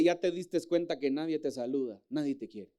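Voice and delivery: low, soft sneering whisper of the enemy; low mocking voice of the devil; enemy's taunt. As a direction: hiss it like a tempter in the ear. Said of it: "el enemigo te dice".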